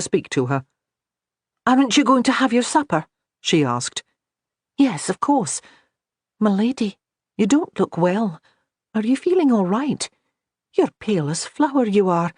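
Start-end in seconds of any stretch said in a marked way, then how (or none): 1.7-12.3 put-on a young maid, pitched higher, fretting over her lady; higher-voiced fussing maid. Megan worrying over her pale mistress, higher and fretful. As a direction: bustle in, concerned and quick to worry.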